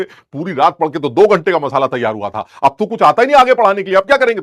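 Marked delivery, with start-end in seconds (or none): 2.6-4.4 high, loud mock helplessness; retold panic. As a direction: replay being caught out with nothing prepared. Replays his panic at extra class. (घबराहट की ऊँची, नाटकीय नकल)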